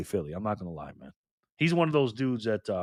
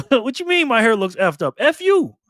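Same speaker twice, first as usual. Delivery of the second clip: mock outrage; 8 semitones higher, 6 dB louder than usual. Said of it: "you're like".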